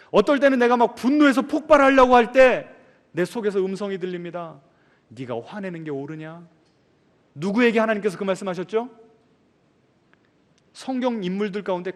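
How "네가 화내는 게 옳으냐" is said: soft inner voice. Acted. God's inner voice quoted.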